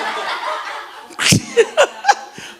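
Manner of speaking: high, rising burst of laughter; cackling laughter at his own joke. Real, not acted. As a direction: let the laugh climb. Big laugh.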